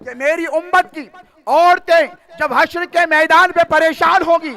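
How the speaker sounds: high, loud preaching surge; fervent preaching. Real, not acted. Preacher telling women of intercession, loud and high. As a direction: build the promise to a fervent peak.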